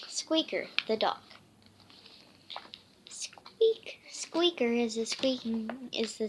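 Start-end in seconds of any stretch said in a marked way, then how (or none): 3.1-4.0 a high, breathy whispered squeak. Pretend. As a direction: whisper the squeak like a tiny dog. Child voicing the dog's squeak.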